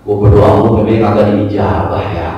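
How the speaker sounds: low-pitched mock grumble; acted inner voice of doubt. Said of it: "muncul keraguan di hati kita".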